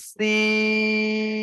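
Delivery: সিন with the long stretched hiss; drawn-out Arabic seen. Shown as a demonstration. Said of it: "এরও বীজখানে টান হবে". (টেনে লম্বা করে বলা সিন)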